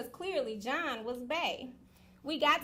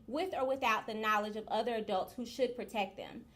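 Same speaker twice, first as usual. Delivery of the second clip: normal talking voice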